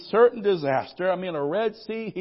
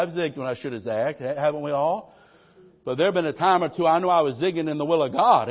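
ordinary talk, nothing marked

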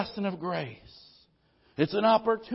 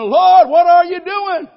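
much higher, louder, slow outcry; anguished cry to God; 9 semitones higher, 12 dB louder, slower than usual. Retold or imitated. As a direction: cry out to the Lord in disbelief.

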